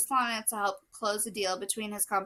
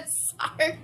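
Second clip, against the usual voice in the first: breathy sorry through laughter. Real, not acted. Reader apologizes mid-laugh, whispered sorry.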